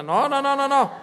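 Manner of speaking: loud repeated no; emphatic denial. Real, not acted. Preacher loudly rejecting the idea that Pablo drank. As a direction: cut the idea off firmly.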